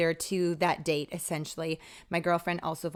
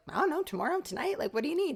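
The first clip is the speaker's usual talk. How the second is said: much higher, eager rush of offers; 9 semitones higher, faster than usual. Retold or imitated. Offering to start for the mom at once, high and eager. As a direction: sound thrilled to say yes.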